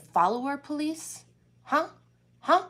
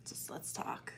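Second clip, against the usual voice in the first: whisper; 18 dB quieter than usual. Real, not acted. Whispered aside.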